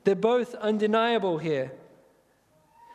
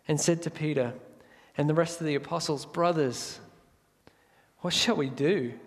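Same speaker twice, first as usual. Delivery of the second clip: hushed scripture reading; 4 semitones lower than usual. Acted. Pastor reading Acts to the congregation, hushed and reverent.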